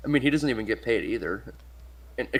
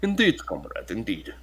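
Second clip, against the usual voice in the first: put-on Russian accent. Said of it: "proceeds to do a Russian accent".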